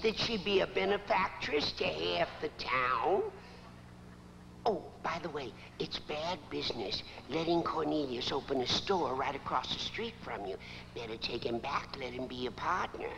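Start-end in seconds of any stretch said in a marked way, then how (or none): 4.7-13.1 quick, low, softened aside; casual slipping-in. Acted. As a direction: toss it in fast and offhand. Slipping in a business demand.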